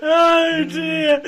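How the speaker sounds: laughing oh dear; loud, high, delighted; amused exclamation. Real, not acted. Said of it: "That's just so wonderful".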